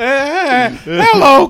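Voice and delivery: overdone amateur improv voice; improv character. Pretend. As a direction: burst in with a hammed-up high greeting. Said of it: "love to see you do improv".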